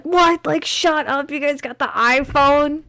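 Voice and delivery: high, loud envious disbelief; mock jealous outrage. Retelling her jealous reaction to a friend, high outraged squeal. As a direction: squeal it like you can't believe their luck. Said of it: "so mad 'cause I was just like".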